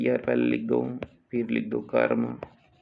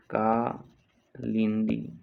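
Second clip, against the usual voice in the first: slow, split Kalindi; syllable by syllable; much slower than usual. Shown as a demonstration. Teacher dictating to students, Kalindi slowly in parts. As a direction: pause between "kaa" and "lindi" in Kalindi.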